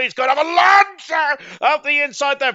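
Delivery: loud, high burst of race calling; excited shout. Real, not acted. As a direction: call the lunge at full voice. Overtake lunge called live.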